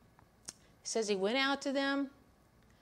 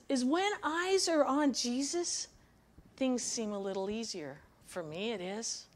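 normal talking voice

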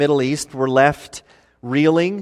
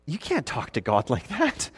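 hushed aside; 2 semitones lower, 11 dB quieter than usual. Retold or imitated. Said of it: "my gut reaction is".

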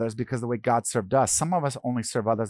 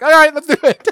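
much higher and louder, bursting with excitement; eager agreement; 13 dB louder than usual. Retold or imitated. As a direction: cheer it like the whole family said yes.

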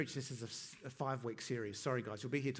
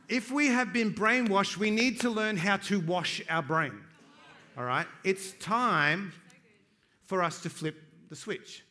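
louder and higher, driving the point home to the room; rally the congregation with rising conviction; preaching at full force; 5 semitones higher, 8 dB louder than usual. Acted. Pastor urging his congregation, preaching with full force.

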